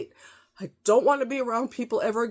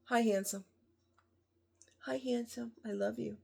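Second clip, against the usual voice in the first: much softer, murmured endearment; soft love to someone close by; tender aside; 3 semitones higher, 13 dB quieter than usual. Real, not acted. Greeting someone off camera, very softly.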